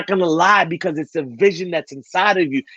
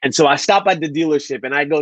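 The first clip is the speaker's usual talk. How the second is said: normal talking voice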